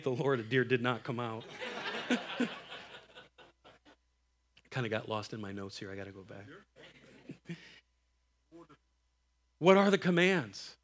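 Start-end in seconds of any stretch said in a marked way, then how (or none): none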